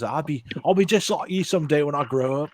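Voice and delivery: lightly put-on New Zealand accent; a weak stab at a Kiwi accent. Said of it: "I can't do the Kiwi voice like he does".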